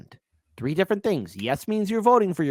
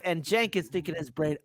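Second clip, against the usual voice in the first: normal talking voice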